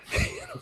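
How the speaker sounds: a quick high burst of laughter; short amused laugh; sudden high laugh. Real, not acted. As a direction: laugh in disbelief. Laughs at the phrase.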